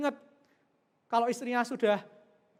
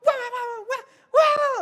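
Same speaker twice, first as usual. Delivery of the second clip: much higher and louder exploding wah wah; husband blowing up; 13 semitones higher, 10 dB louder, slower than usual. Acted. Husband escalating the quarrel instead of hugging, loud high outburst. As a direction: escalate into a shrieking tirade. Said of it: "jangan malah".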